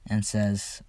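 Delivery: ordinary delivery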